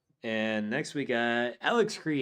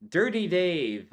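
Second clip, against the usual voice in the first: "Dirty Dave" called out louder and higher; hyped name announcement; 6 semitones higher, 6 dB louder than usual. Acted.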